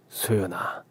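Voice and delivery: unvoiced whisper of her name. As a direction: call her softly in a whisper.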